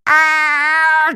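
high, loud startled yelp; frightened giant cry. A narrator's reading. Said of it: "cried the giant".

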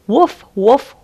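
storybook dog woof; dog barking. Acted. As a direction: bark at the cat. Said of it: "It saw Tabby the cat".

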